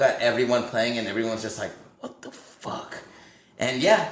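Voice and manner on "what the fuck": very soft, breath-only disbelief; stunned whisper. Retold or imitated. Band watches burning men while playing, stunned whisper. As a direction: imitate a dumbstruck onlooker under the breath. Retelling everyone's stunned reaction.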